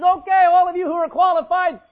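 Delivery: loud, high daring call; commanding challenge. Acted. Jesus daring the accusers to throw, loud and commanding. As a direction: dare them to step forward.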